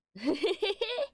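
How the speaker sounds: sudden high burst of laughter. Real, not acted. Laugh after Caroline.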